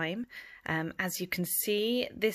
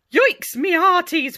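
high, loud hail; pirate call; 10 semitones higher, 12 dB louder than usual. Acted. Pirate captain hailing his crew on the shore. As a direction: hail the hearties.

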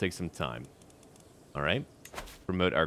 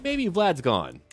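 higher, lightly voiced mocking merchant; 10 semitones higher, slower than usual. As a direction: tease the merchant's hopeful guess in a higher voice. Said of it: "He's like".

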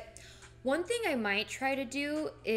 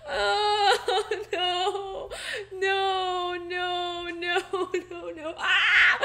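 high, loud laughing-crying protest; mock panic cries; hammed-up wailing no; 5 semitones higher, 7 dB louder, much slower than usual. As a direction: wail against the sinking. Ship sinking, panic.

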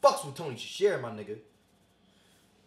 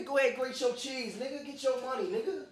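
ordinary talk

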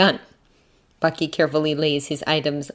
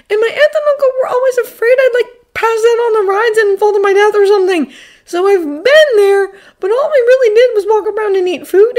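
put-on high, loud boy's voice; Peter, embarrassed and rambling; 8 dB louder than usual. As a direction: explain haltingly, then spill it all out.

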